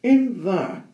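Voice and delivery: high, loud cheery goblin hello; squeaky goblin greeting; little goblin. Acted. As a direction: call out like a tiny chirpy goblin. Goblin voice in story.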